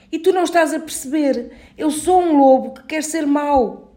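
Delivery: a small wolf eager to be bad; little wolf voice. A narrator's reading. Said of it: "little wolf insists".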